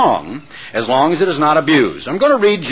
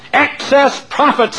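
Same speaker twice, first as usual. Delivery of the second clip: mild mock scream; 11 semitones higher, slower than usual. A narrator's reading. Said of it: "screamed the cow".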